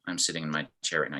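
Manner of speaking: soft, quick, listless; flat-out apathetic and low-energy. Shown as a demonstration. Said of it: "he might be feeling apathetic".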